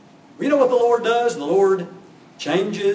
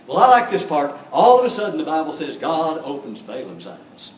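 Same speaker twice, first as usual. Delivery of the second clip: ordinary delivery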